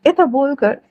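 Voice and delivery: plain reading voice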